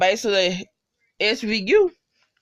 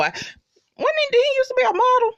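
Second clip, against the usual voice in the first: excited squeal. Real, not acted. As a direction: ask it like you can't believe it. Excited question about the actor.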